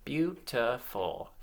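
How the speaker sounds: "beautiful" split as beau, ti, ful; syllable by syllable. Shown as a demonstration. Teacher counting syllables along with children, syllable by syllable. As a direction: count off "beautiful" in three even beats. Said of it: "count the syllables".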